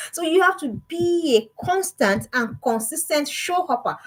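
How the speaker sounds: slow, spelling out each word of constant and consistent show hopper; drawing out constant and consistent show hopper so it sticks; slow and deliberate. Shown as a demonstration. Coach repeats her new phrase to parents, slow and deliberate. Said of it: "I coined the English".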